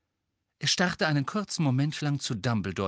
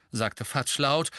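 plain reading voice

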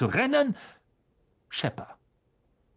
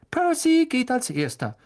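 nervous mother; 11 semitones higher, 3 dB louder than usual. A narrator's reading. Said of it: "blickte nervös auf die Uhr".

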